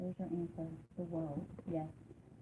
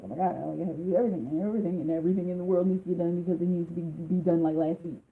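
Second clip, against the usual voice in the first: fast, louder mock panic; frantic overwhelmed person; 6 dB louder, faster than usual. Reader mimicking the client's frantic inner voice, fast mock panic. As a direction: rattle it off like someone swamped with to-dos. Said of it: "be like".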